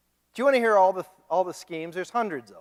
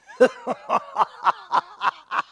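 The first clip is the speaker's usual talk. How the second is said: a burst of high, amused laughter; laughing at the 666 punchline; 4 dB louder than usual. Real, not acted. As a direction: break into a laugh at your own joke. Laugh after the 666 joke.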